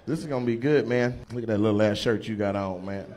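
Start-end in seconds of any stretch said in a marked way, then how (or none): none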